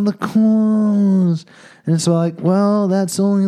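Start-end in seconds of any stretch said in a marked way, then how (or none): 0.0-1.5 mock mourning. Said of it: "everybody's like".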